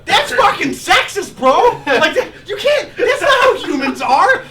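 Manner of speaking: loud, high, slow mock fury with laughter; laughing outrage. Real, not acted. Ranting to friends about old films, loud laughing outrage.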